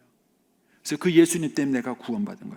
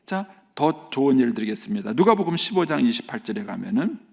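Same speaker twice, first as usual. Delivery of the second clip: normal talking voice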